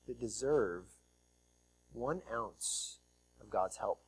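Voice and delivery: hushed. Acted. Preacher to congregation on undeserved grace, hushed low fade. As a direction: drop to a near hush on one ounce.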